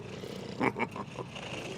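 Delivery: a quiet, amused laugh under the breath; soft mocking chuckle. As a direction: chuckle at your own joke. Savathûn laughs at her jest.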